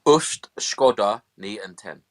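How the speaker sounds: slow, deliberate fake Dutch, loud; mock Dutch. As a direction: sound out nonsense Dutch word by word. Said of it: "Go on, speak some Dutch".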